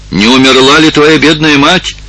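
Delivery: high, worried questioning; anxious question. A narrator's reading. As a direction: ask fearfully, pitch climbing. Dolores fears bad news for girl. (тревожный вопрос высоким голосом)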